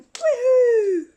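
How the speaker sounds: high, loud victory whoops; excited Pink Ranger. Pretend. Pink Ranger celebrating her kick, high squealing cheers. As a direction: cheer like you just won the fight.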